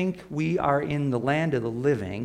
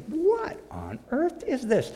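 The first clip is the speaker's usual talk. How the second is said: much higher, slow astonished question; "What" rising high and slowing in amazement; 12 semitones higher than usual. Retold or imitated. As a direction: voice jumping up in wonder. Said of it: "You say".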